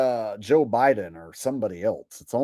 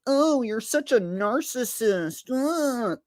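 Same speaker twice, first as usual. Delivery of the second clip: mocking critics; 11 semitones higher, slower than usual. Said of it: "there's people who are like".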